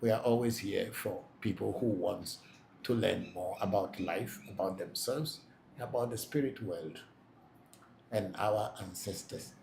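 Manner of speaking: ordinary delivery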